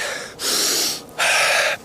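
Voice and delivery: one deep, audible breath in and out; big deep breath. Shown as a demonstration. Coach demonstrates taking in good air, big deep breath. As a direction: take a big breath through the nose. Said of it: "Big deep breath".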